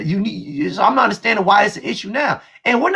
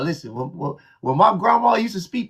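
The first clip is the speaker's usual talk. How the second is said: usual speaking voice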